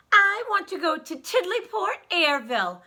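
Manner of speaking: high, loud eager voice; bursting with excitement; excited little girl voice; excitedly. A narrator's reading. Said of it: "said Little Miss Star excitedly".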